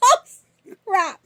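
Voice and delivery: high laugh through the pain; pained laughter. Real, not acted. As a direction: laugh while it hurts.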